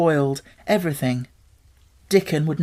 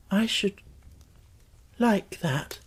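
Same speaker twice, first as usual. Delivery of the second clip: soft, slow and dreamy; very slowly; 6 semitones higher, 4 dB quieter, slower than usual. A narrator's reading. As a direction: let each word linger. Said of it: "he said very slowly".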